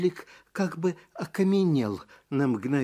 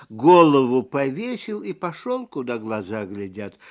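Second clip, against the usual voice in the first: usual speaking voice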